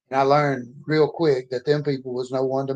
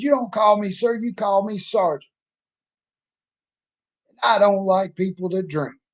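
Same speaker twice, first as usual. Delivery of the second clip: angry drill sergeant; 6 semitones higher than usual. Retold or imitated. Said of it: "the angriest man I'd ever met".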